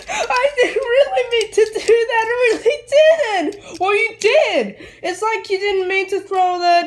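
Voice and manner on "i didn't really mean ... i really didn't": sobbing, high-pitched apology; crying plea. Real, not acted. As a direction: cry out the excuse through sobs. Child crying after tantrum.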